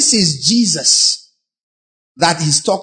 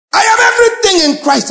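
much higher, loud mock boast; mock boastful believer; 4 dB louder, faster than usual. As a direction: lampoon a showy declarer of faith.

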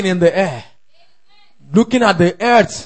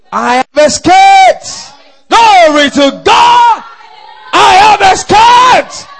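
high, drawn-out jubilant shout; triumphant shout; 13 semitones higher, 9 dB louder, slower than usual. Real, not acted. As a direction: cry it out long and loud in triumph. Preacher's triumphant declaration to congregation.

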